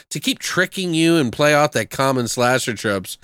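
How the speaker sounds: ordinary talk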